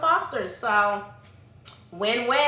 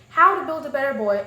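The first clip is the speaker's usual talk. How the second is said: normal talking voice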